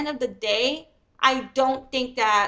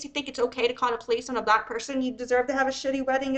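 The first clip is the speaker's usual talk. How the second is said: ordinary talk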